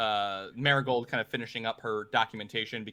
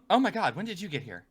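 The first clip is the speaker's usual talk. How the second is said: higher, startled gasp of a question; 6 semitones higher than usual. Acted. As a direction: jolt up from the paperwork, surprised. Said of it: "Marigold finally looks up and says".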